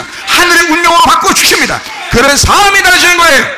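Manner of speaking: loud, high impassioned preaching; fervent preacher's cry; full-force preaching. Acted. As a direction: preach it out to the whole congregation. Preaching climax.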